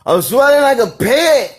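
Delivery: very high, loud cartoonish whine; exaggerated silly voice. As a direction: ham it up like a whining cartoon. Exaggerated repeat of complaint.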